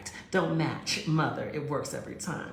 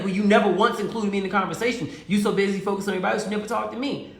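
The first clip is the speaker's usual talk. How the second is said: loud, fast complaint; angry boyfriend; 3 semitones higher, 7 dB louder, faster than usual. Retold or imitated. Boyfriend complains she ignored him at the event, loud. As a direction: rattle off the grievance, raised.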